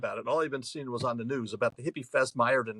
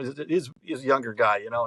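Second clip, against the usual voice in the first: normal talking voice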